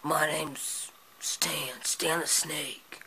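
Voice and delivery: slow whispered snake voice; whispery snake. Acted. Kid's puppet snake introducing himself, slow whisper. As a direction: breathe it out like a sly snake.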